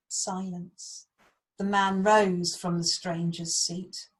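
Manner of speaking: slow, dramatic storytelling with loud swells; story narration. A narrator's reading. Teacher reads Beowulf to Year Four, dramatic narration. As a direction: build suspense, then hush for the silence.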